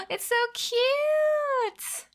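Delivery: high, slow, squealing coo; gushing over cute. Real, not acted. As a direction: melt over the emote. Sees a viewer's cute emote.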